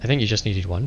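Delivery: normal talking voice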